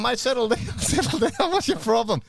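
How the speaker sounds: half-laughing, act out Tommy soothing the angry bloke; Tommy calming the fighter. Retold or imitated. Tommy on the plane telling Paul Ferrari to calm down, laughing. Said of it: "Tommy's going".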